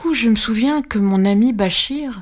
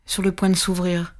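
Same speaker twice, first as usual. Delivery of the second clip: ordinary delivery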